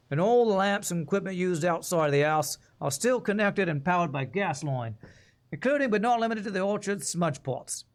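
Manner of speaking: put-on reading aloud in a wandering Cockney-Australian accent; shifting British-Australian accent. Player voices a game's journal notes, shifting British-Australian accent. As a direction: attempt a Cockney read that slips into Australian. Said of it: "I changed accents from Australian to Cockney".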